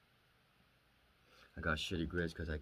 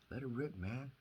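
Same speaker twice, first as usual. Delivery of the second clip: higher, swooping mock holler; brother's loud urging; 8 semitones higher than usual. Younger sibling quoting his brother's pep talk, higher-pitched urging. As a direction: imitate a brother cheering you on, pitched up. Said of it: "He was loud".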